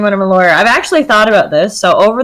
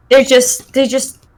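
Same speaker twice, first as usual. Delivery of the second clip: ordinary talk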